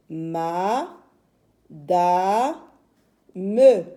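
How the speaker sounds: "Ma, da, me" slowly split in three; syllable by syllable. Shown as a demonstration. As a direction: dictate "Ma, da, me" beat by beat. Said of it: "On a trois syllabes".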